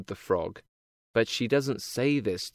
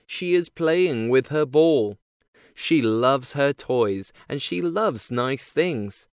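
plain reading voice

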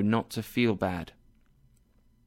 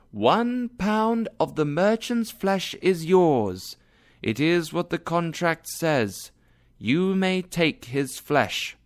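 slow, much higher judge's voice, solemn; Portia as judge; 7 semitones higher, slower than usual. A narrator's reading. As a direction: pronounce the verdict slowly, pitched high.

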